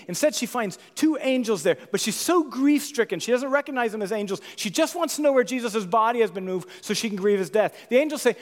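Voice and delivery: loud, high storytelling from the pulpit; preacher retelling Mary at the tomb, loud and urgent; impassioned sermon narration. Acted. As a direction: tell it with rising fervour.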